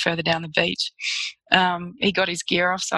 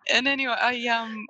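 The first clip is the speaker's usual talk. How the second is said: ordinary talk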